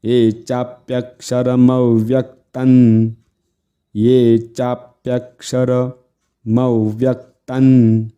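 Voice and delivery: slow, steady Sanskrit chant; recitation drill. Shown as a demonstration. Teacher repeats the line for students, slow chant. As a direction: chant evenly, holding long syllables.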